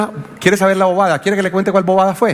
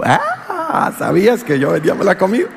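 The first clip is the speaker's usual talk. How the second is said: playful teasing. Retold or imitated. Mocking greeting to his wife.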